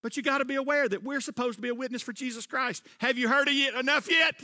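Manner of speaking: usual speaking voice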